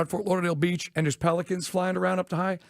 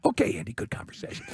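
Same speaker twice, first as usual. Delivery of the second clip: airy, slightly higher mock-cheerful reply; mock friend voice; 4 semitones higher, 4 dB quieter than usual. The friend ends the chat, airy mock cheer. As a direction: brush him off awkwardly.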